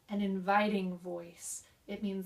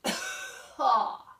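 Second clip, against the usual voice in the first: cough into speech; 3 semitones higher, 8 dB louder than usual. Shown as a demonstration. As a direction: cough that rolls into voice. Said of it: "from that cough, we are then going into our speech".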